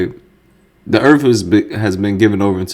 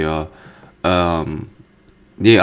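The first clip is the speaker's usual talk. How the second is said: normal talking voice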